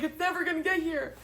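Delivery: high, strained mock whine, pitched well up; exasperated whine. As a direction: whine like the finish will never come. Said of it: "And I was like".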